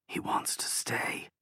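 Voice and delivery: breathy hushed whisper, slow. A narrator's reading. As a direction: whisper it so only she hears. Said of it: "Dan whispered".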